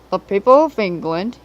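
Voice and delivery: loud, commanding speech to the engines; booming Fat Controller. A narrator's reading. The Fat Controller announces his plan, loud and commanding. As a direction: address the whole shed with authority.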